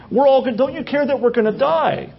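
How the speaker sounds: a touch of frantic reproach; panicked. Acted. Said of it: "and they're all panicked".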